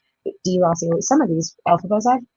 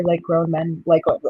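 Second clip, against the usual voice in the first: ordinary talk, nothing marked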